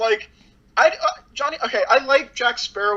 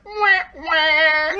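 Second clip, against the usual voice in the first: high, whining wah wah sound effect; imitating the film's comedic whine sound; mock whiny wah wah; 8 semitones higher than usual. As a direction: mimic a sad trombone whimper, pitched up. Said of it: "And it's like".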